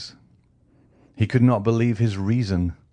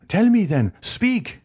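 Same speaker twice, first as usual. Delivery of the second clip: louder, much higher desperate outburst; frantic demand; 13 semitones higher, 4 dB louder, slower than usual. A narrator's reading. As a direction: demand it on the edge of madness. Villefort demands an answer.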